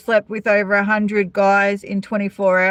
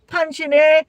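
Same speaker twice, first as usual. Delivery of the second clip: higher, gloating cheer; mock triumphant; 8 semitones higher than usual. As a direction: imitate a man punching the air in victory. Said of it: "they're either really happy".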